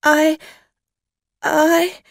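high, loud frightened stammer; falter, lost for words in fear; very afraid. A narrator's reading. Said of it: "was suddenly very afraid".

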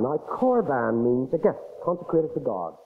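ordinary talk

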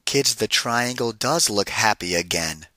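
ordinary delivery, nothing marked

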